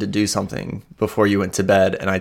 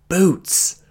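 high, pitched-up one-word announcement; pointed announcement; 9 semitones higher than usual. Acted. Sorrel points at the woman, announcing pointedly. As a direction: point it out in a character voice.

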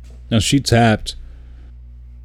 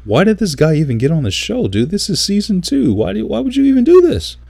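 exasperated rant. Retold or imitated. Fuming at the contestant who quit in season two, incredulous rant. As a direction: pitch shooting way up in disbelief. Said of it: "I'm like".

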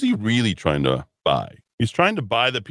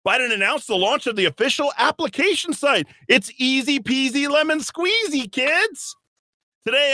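very high, sing-song sarcastic read; mock upbeat ad voice; mocking cheery announcer; 11 semitones higher than usual.

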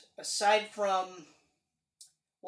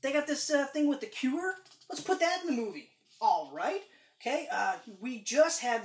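higher, excited mock pitch; imitating eager studio executives; 6 semitones higher, 4 dB louder than usual. Studio people cramming in comic plots, mock-enthusiastic. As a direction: toss out ideas eagerly, higher-voiced. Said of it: "they were like".

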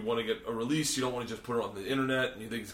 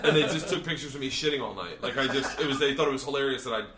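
usual speaking voice

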